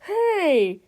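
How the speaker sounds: high, loud angry shout. A narrator's reading. Old lady stuck in a tree, shouting at the hero. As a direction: yell down from the tree. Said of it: "shouted one of the old ladies".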